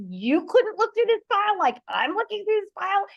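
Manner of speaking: high, loud, furious rant at husband; Hulk-mode rant. Retold or imitated. Wife blowing up at husband over missing pants, high furious rant. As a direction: unleash the Hulk on him. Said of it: "see the green monsters go".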